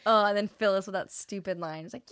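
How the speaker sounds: ordinary delivery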